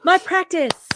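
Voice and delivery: loud, high sing-song mimicry of a repeated phrase; loud, high mocking parrot voice; mocking imitation. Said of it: "mocking Percy's repeated phrase".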